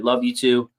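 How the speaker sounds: ordinary delivery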